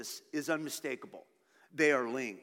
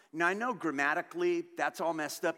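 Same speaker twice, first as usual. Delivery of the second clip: usual speaking voice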